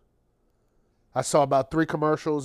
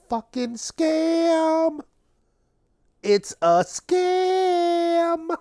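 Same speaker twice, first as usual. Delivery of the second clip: mock outrage; 14 semitones higher, much slower than usual. Real, not acted. Ranting against Valentine's Day.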